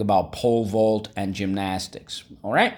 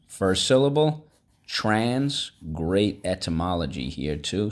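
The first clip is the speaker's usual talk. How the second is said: ordinary talk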